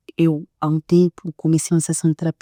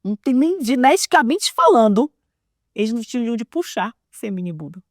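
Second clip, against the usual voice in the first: ordinary talk, nothing marked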